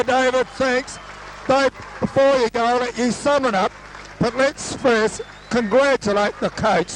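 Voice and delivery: ordinary talk, nothing marked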